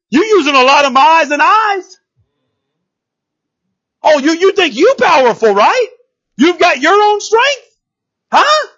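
high, taunting questions; calling him out. Retold or imitated. God taunts the enemy's pride, calling him out. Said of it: "he's calling him out".

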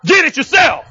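commanding retort to a rude guest; with authority. Shown as a demonstration. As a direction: say it like you mean it, with authority. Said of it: "You got to say it with some authority now".

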